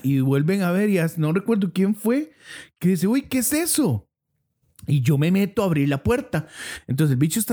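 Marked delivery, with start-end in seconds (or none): 3.1-4.1 high frightened gasp of a question; spooked by something at the window; scared. Retold or imitated. Quoting a frightened bystander.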